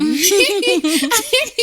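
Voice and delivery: bright burst of laughter; embarrassed laugh about loving yam. Real, not acted.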